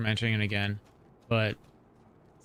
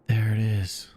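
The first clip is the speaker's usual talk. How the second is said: flat Mandalorian quote; 4 dB louder than usual. Retold or imitated. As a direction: say it flat and unimpressed. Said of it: "and the Mandalorian says".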